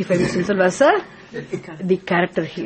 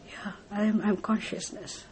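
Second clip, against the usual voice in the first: much softer, dawning recognition; hushed realisation; 11 dB quieter than usual. Acted. Seeker realising the truth, hushed. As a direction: breathe the answer.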